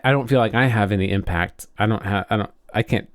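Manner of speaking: normal talking voice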